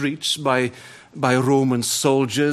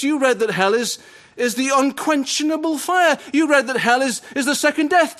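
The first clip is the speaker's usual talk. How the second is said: frantic; 10 semitones higher than usual. Retold or imitated. Prisoner to chaplain on the way to the gallows, frantic dread. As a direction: pile it up in rising dread.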